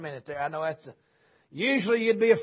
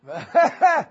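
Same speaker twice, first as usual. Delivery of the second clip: short burst of higher, louder laughter; hearty laugh; 8 semitones higher, 8 dB louder than usual. Real, not acted. Preacher joking about the old building, hearty laugh. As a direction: laugh out loud at your own joke.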